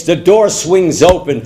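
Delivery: ordinary delivery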